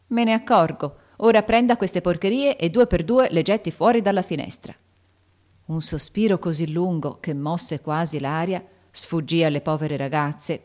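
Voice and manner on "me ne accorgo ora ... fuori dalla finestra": angry order. A narrator's reading. Irate teacher orders candy thrown out.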